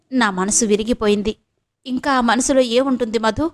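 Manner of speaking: ordinary delivery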